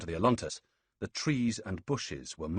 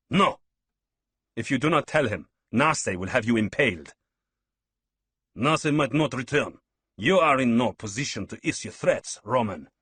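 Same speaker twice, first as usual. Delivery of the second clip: loud, higher heated threats; angry threat; 5 semitones higher, 7 dB louder than usual. Acted. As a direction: bark back at the Roman. Heated quarrel with Iberian leader.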